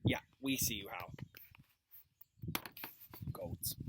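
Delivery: ordinary delivery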